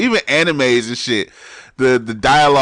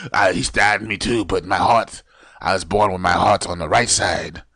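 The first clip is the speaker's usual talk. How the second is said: low, slow imitation of a Japanese accent; old man's put-on Japanese accent, lower; bad Japanese accent; 7 semitones lower than usual. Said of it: "Terrible Japanese accent".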